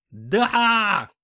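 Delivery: loud, high desperate yell. A narrator's reading. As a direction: bellow the confession. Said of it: "заорал бывший конюх".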